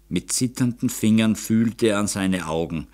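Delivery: plain reading voice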